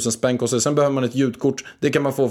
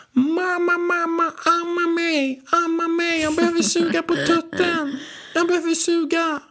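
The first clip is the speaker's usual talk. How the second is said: very high, sustained mock baby wail; screaming baby; slower than usual. Partner imitates the baby demanding to be breastfed, high wailing scream. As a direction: whine it out on one high note like a hungry baby. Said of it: "Han kommer ju skrika så här".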